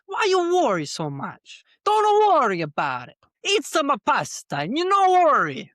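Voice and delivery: New York Italian, loud and high; hammy New York Italian voice; Italian accent. Retold or imitated. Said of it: "you sounded like you were from New York".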